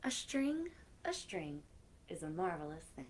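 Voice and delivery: lilting storybook reading; reading to children. Acted. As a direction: read the rhyme to the kids with playful lilt. Reading new book aloud.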